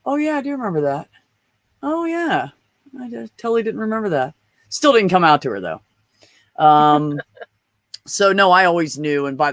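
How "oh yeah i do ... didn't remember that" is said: much higher, startled realization; sudden recall. Retold or imitated. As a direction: voice jumps up as the memory hits. Sudden memory after gasp.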